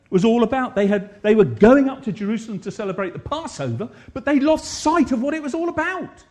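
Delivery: loud, high impassioned preaching; preacher driving the point home, loud and high. Acted. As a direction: build the sermon to full force. Preaching at full force.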